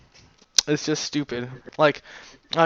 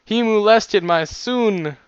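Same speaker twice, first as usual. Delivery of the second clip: slow, raised mock-accusing parent voice; outraged parents; 6 semitones higher, 4 dB louder, slower than usual. Parents accusing the author over the child, mock outrage. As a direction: draw out a high, mock-horrified accusation. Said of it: "the parents are like".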